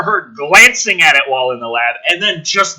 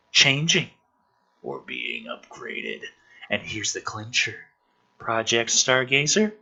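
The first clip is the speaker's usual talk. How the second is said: hushed, low read-through of the film scene; quiet script reading; 7 semitones lower, 6 dB quieter than usual. Acted. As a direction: murmur the characters' lines low.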